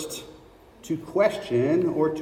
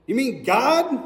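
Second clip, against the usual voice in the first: amazed Jack; 7 semitones higher than usual. Acted.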